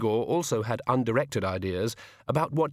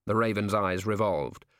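plain reading voice

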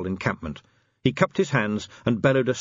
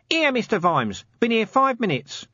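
high-pitched lowly copper; Nobby; 13 semitones higher than usual. Acted. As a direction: pipe up in a raised character voice. Nobby answers from the shadows.

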